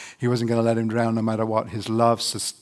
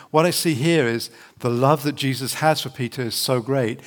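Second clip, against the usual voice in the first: normal talking voice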